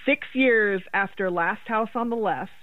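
usual speaking voice

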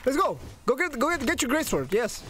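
high-pitched, excited calling out; hyped-up goading. Real, not acted. Player dares opponent to go get gray sword, hyped up. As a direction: egg the opponent on, all fired up. Hypes up after a kill.

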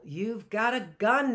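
put-on boy blurts out in surprise at the gun; cried out. A narrator's reading. Matt cries out at the new rifle, excited. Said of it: "Matt cried".

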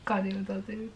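a real sob in a whisper; real crying. Real, not acted. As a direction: voice gives way to tears. Crying mid-sentence about sister.

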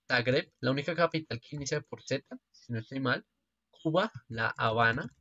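ordinary talk, nothing marked

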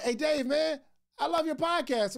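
high, eager fan voice; a lightly voiced excited fan. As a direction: gush like a fan stopping you in the street. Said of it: "they're like".

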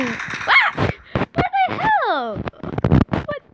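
sudden high startled yelp. Real, not acted. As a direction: jump and yell in surprise. Startled in game.

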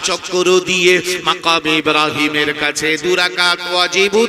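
very loud, half-sung preacher's delivery; full-force preaching. Acted. Preacher retells the Prophet and Abu Bakr to the congregation, loud chanted preaching.

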